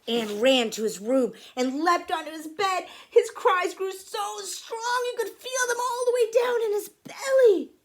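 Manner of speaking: high, loud, building to a peak; intense dramatic narration. A narrator's reading. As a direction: narrate the outburst at full force. Narrating the boy's storm of crying.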